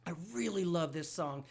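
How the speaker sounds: ordinary talk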